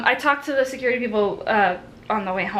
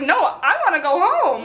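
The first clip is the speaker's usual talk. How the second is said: high, loud, laughing plea; laughing whiny protest; 8 semitones higher, 5 dB louder than usual. Retold or imitated. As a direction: whine it through a laugh. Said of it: "And I was like".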